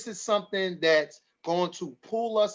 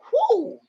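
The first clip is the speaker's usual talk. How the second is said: whew; 7 dB louder than usual. Real, not acted. Overwhelmed host exhales.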